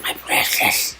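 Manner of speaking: whispery, lightly voiced Gollum imitation. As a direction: hiss it like a creature clutching treasure. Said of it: "I can't really do the Gollum voice".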